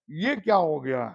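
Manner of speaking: ordinary talk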